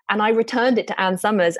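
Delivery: ordinary talk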